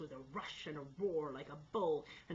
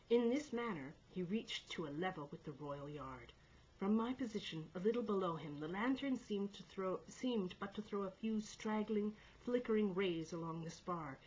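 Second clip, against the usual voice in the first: usual speaking voice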